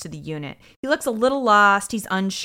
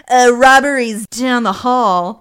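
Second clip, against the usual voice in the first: loud, slow, drawn-out dismissal; mock brush-off; 4 semitones higher, 11 dB louder, slower than usual. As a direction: send him away with exaggerated volume. Mimicking detectives cutting the vendor off.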